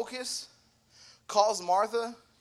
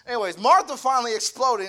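"Martha" hit louder, the line pushed out with force; building to an outburst; 3 semitones higher, 6 dB louder than usual. Shown as a demonstration. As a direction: let "Martha finally exploded" burst out. Rereads the verse with force.

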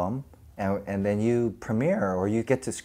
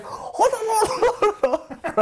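much higher, laughing "hold on"; laughing call; slower than usual. Retold or imitated. Roger chasing the truck calling them back, high and laughing.

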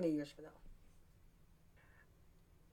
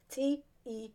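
slow letter-by-letter spelling; slow, deliberate spelling of her name; slower than usual. Shown as a demonstration. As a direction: spell each letter slowly and clearly. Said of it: "Spell it right".

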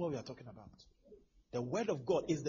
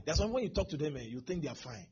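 normal talking voice

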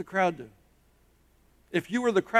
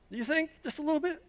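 teasing aside; 8 semitones higher, 5 dB quieter, much faster than usual. Real, not acted.

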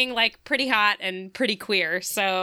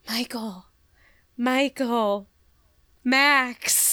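amorous name-calling; much slower than usual. Retold or imitated. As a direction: call the names as if still kissing. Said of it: "say their name the same way".

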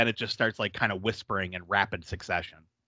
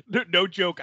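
ordinary talk

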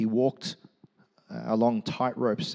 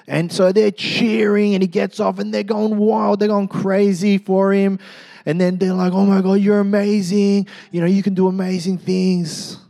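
higher, louder building excitement; excited telling; 8 semitones higher, 6 dB louder than usual. A narrator's reading. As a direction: tell it like the crowd's roaring. Excited retelling of the cheering.

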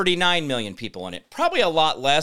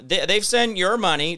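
ordinary talk, nothing marked

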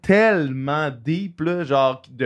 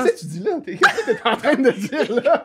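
high, incredulous laughing question; laughing disbelief; 10 semitones higher than usual. Real, not acted. Teasing co-host who cries at a singer's video, laughing disbelief. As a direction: ask it through real laughter, pitch shooting up.

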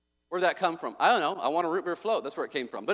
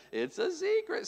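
much higher, softer sing-song secret; 9 semitones higher, 6 dB quieter than usual. Shown as a demonstration. Dad imitating the trained kids, high sing-song. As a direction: chirp it like a toddler would. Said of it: "They have to say it like that too".

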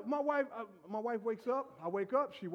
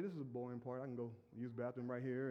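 bored; 12 semitones lower, 10 dB quieter than usual. Retold or imitated. Bored second viewing.

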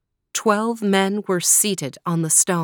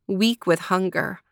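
plain reading voice

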